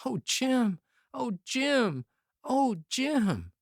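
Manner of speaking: high anguished wail of a name; lamenting. Acted. Youth lamenting over his dying friend, high wailing. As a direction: keep crying the name in grief. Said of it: "The youth still lamented".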